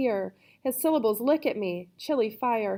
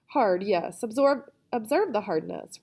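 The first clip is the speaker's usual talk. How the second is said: plain reading voice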